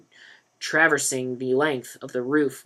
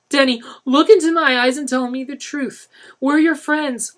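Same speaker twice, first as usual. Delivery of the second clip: much higher, louder plea; pleaded; 13 semitones higher, 10 dB louder than usual. A narrator's reading. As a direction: plead with him. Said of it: "Lisa pleaded".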